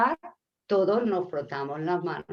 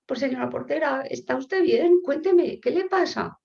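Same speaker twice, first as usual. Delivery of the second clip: high, solicitous questioning; concerned visitor; 9 semitones higher, 5 dB louder than usual. Acted. Flower bearer checking on the concierge, high acted concern. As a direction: ask with exaggerated, raised concern. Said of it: "Y va la portera".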